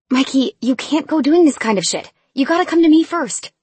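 loud, high big-sister scolding. A narrator's reading. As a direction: tell him off, exasperated. Scolding her little brother.

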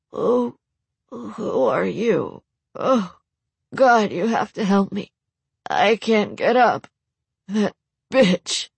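high, slow, desperate pleading; injured man. Acted. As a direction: beg for help through pain.

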